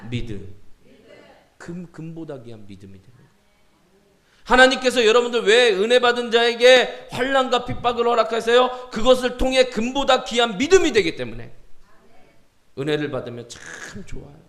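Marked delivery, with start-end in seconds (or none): 4.4-11.5 raised, forceful pulpit cry; full-force preaching. Acted. Preacher explaining trials to the congregation, full force. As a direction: ask and answer at the top of the voice.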